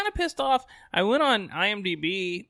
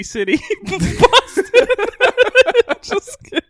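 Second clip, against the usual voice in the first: high, loud laughing through words; cracking up while talking; 11 dB louder than usual. Real, not acted. Laughing at own geography joke.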